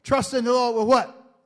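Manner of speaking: loud rising preacher call; preaching at full force. Acted. Preacher calling out to the church, loud. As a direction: hammer the question at the congregation.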